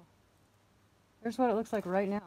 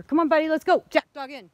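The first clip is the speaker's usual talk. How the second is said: loud, higher call to the dog; 7 semitones higher, 16 dB louder than usual. Real, not acted. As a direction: call Jack back to the path. Calling the dogs.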